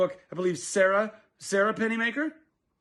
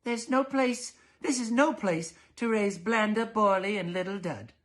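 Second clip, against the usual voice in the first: higher, firm fussy mother; prim mother voice; 7 semitones higher than usual. Acted. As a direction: declare it unfit, primly. Mrs Dollard voiced.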